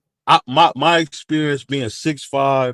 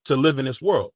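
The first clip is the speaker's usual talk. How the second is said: ordinary talk, nothing marked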